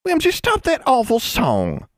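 high, sharp scolding sister. A narrator's reading. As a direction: snap at her little sister.

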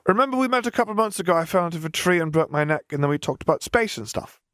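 put-on high, quick little girl's voice, settling at the end; a child rattling off what happened; chattering child. A narrator's reading. Girl reminding Death how they met, quick and high.

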